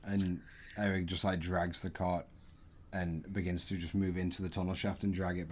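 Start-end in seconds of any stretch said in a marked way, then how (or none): none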